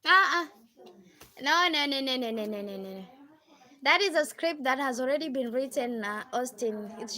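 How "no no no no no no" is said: usual speaking voice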